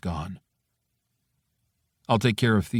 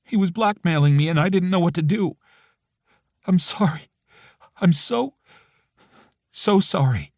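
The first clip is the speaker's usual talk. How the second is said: higher, trembling tearful woman; tearful apology; 10 semitones higher than usual. A narrator's reading. Friend apologizing to the narrator, tearful. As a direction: plead sorry through near tears. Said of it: "her voice breaking".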